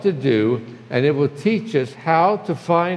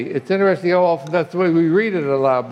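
normal talking voice

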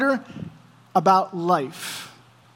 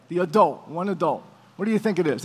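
ordinary delivery

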